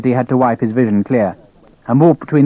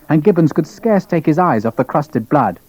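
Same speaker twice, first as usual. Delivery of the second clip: usual speaking voice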